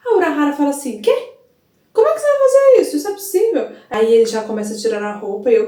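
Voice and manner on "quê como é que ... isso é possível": much higher, louder, fast and incredulous; shocked disbelief. Retold or imitated. Urahara shocked when Kitaki says he'll replace the Soul King, surprised. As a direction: gasp out the question as if this can't be happening.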